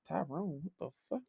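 hushed mock disbelief; startled undertone. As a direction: react under your breath, playing it up. Acts out reacting to seeing Tyrone.

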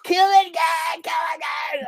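sustained high-pitched crazed shriek; madman screaming. Retold or imitated. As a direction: scream it like a man gone berserk, hammed-up. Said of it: "like, a madman".

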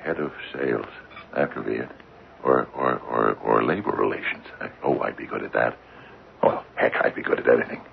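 low, soft thinking aloud; musing to himself. Acted. Father alone guesses which job awaits him, musing softly. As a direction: try out hopeful ideas under your breath.

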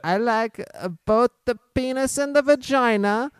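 put-on Borat accent. Retelling what the friend's son said, in Borat accent. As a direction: mimic him with a Borat-like accent. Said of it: "like, a Borat accent".

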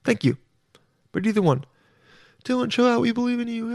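high, soft supportive listener voice; encouraging listeners. Retold or imitated. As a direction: imitate fans cheering Dylan on gently. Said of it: "you're thinking".

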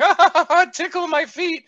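high, loud, silly laughing voice; gaga smile voice. Retold or imitated. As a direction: ham up a giddy grin. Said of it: "walk around with a gaga smile".

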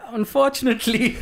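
put-on old German guy. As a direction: imitate the German elder. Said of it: "the old German guy says".

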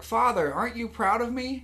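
high, pleading little robot; Nepter. Retold or imitated. Robot seeking father's approval, high and pleading.

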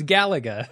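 "Galaga" stressed on the first syllable, GAL-uh-guh; corrected pronunciation. Shown as a demonstration. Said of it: "People are gonna freak out with you saying Galaga".

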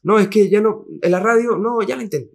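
high, sniffy mock industry voice; dismissive gossip. Singer mimics industry people writing off an artist, mock sniffy and high. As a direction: brush the artist off with a sneer.

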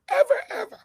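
high, loud ever, ever; emphatic high shout; shouted repeat. Real, not acted. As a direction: yell the words to drive it home. Repeats ever, second take shouted.

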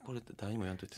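soft, secretive plea to keep it quiet; quietly begging a friend not to tell; hushed request. Real, not acted. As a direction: drop the voice low, like sharing a secret. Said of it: "小声の意味ある？".

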